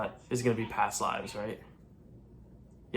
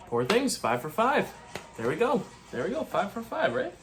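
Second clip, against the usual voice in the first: excited; 9 semitones higher than usual. Real, not acted. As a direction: celebrate getting it right. Celebrating correct predictions.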